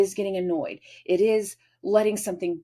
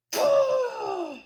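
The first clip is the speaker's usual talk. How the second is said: high offended gasp. Retold or imitated.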